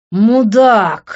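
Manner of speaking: explosive, drawn-out shout; furious yelling. A narrator's reading. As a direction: yell the insult with full anger. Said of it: "заорал я".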